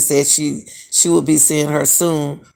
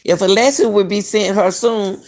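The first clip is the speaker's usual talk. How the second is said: ordinary talk, nothing marked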